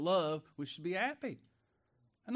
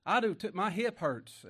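mock grumbling; 4 semitones higher, 5 dB louder than usual. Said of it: "and we grumble, and we complain".

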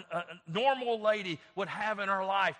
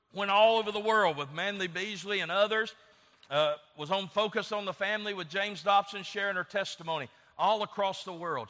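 usual speaking voice

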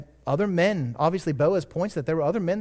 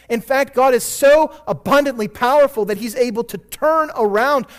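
louder, higher preaching voice, driving it home; full-force preaching; 7 semitones higher, 9 dB louder than usual. Acted. Pastor urging the congregation that God turns evil to good, preaching loudly. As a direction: preach it out big and loud.

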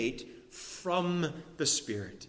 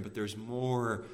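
usual speaking voice

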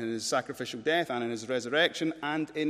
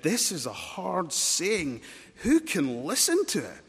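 louder, higher, drawn-out objection; indignant protest; 4 semitones higher, 5 dB louder, slower than usual. Acted. Disciples balking at Jesus' teaching, indignant protest. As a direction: protest it forcefully, leaning on each word.

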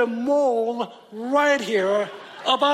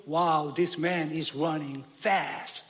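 lower, softer, mildly amazed Wow; a hint of an ancient reader impressed; awed reader; 7 semitones lower, 5 dB quieter than usual. Preacher voicing ancient readers amazed at the dot. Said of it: "the ancient readers are reading".

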